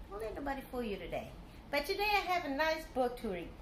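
ordinary delivery, nothing marked

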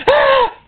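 very high startled shriek; sudden piercing mock scream; demons' scream. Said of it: "And the demons went".

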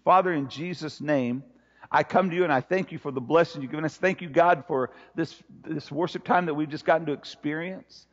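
low, hushed pastoral prayer; earnest prayer. Real, not acted. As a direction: pray softly and low, letting it sink toward the amen. Leads congregation in prayer.

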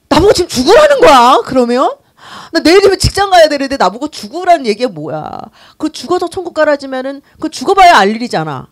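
loud, high-pitched indignant retort; acted-out irritation. Preacher replays her old reaction to hearing about heaven, irritated. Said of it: "그 말이 그렇게 짜증이 나더라고요".

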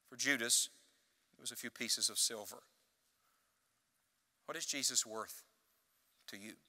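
soft, earnest appeal to each listener; hushed altar call. Real, not acted. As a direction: draw them in close and quiet.

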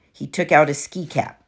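plain reading voice

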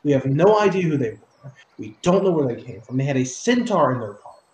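loud, griping accusation; complaining soldier. Acted. Soldier blames his companions, loud and griping. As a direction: blame them loudly. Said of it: "the male voice ... complaining".

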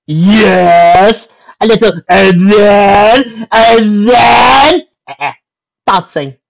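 loud, high, breathless eagerness; excited. Acted. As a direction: blurt it out, way too eager. Eager reply, voice cracking up.

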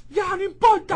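loud, high angry shouting; furious outburst. Acted. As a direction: explode with rage. Estalla de rabia por su ex. (grito de rabia)

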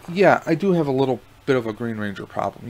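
ordinary talk

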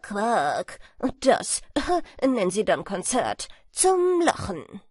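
loud, derisive character voice; mock the croakers loudly, with a laugh; scornful swallow husband. A narrator's reading. Swallow husband mocks the frogs. (spöttischer Schwalbenmann, laut)

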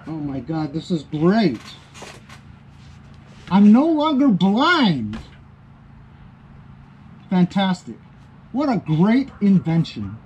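muffled behind mask visor; speaking inside sealed respirator; through a full face mask. Real, not acted. Seen 1: Speaker wears full-face mask with twin filters throughout the labelled words; removed by 11.1.

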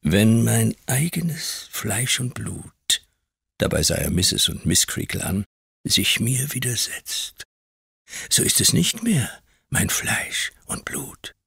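slow menacing whisper. A narrator's reading. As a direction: whisper the threat slowly. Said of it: "flüsterte Mister Creakle".

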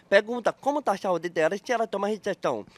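brisk, run together at a normal volume; quick, unbroken question. Shown as a demonstration. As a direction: say it quickly in one go. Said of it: "Quer que eu fale rápido, então?".

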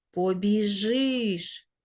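"Побіжиш" very slow, stressed on final и; clear stressed Y. Shown as a demonstration. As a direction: draw out "Побіжиш" so the и is distinct. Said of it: "Ми чітко чуємо звук".

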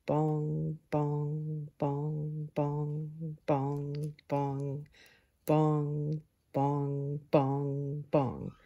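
slow, fading bongs of a clock; clock chiming. Acted. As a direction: toll each bong like a clock striking. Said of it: "The clock struck ten".

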